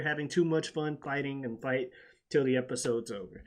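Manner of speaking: ordinary talk, nothing marked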